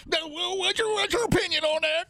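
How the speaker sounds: hammed-up prospector shout; grizzled prospector. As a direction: holler like a wild old miner. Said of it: "impression of the old grizzled prospector".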